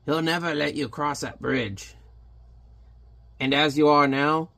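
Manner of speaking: a hint of a sly little creature's warning; lightly voiced pixie. The pixie Belshazzar warning Hellboy, lightly voiced sly imp. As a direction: tip him off like a scheming imp. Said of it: "We'll call him Belshazzar".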